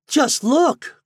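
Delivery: put-on high, loud boy's shout; excited street boy calling; cried out. A narrator's reading. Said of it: "cried one".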